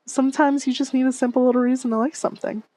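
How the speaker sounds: usual speaking voice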